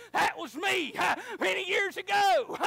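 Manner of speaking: high, loud, laughing testimony; laughing shout. Retold or imitated. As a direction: shout it with joy breaking through. Preacher's tearful testimony, shouted.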